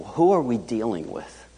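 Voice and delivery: normal talking voice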